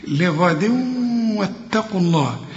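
لغد واتقوا الله with the tanween merged nasally into the waw; nasal merge into waw. Shown as a demonstration. Teacher demonstrating idgham: لغد واتقوا الله nasalized. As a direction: run لغد into واتقوا with a held ghunna. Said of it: "هنا بقى تنوين".

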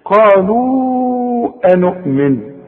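Quran recitation. Shown as a demonstration. Teacher reciting the verse for the student. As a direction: recite قالوا أنؤمن in long held chant. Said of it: "خدت بالك من الهمزة بتاعت أنؤمن".

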